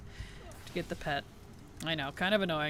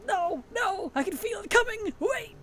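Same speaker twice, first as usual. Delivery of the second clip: high, frantic mock alarm, much higher than her usual voice; a hammed-up plea as a yawn cramp creeps up; mock panic; 13 semitones higher than usual. Retelling her yawn cramp to chat, in high mock panic. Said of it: "and I'm like".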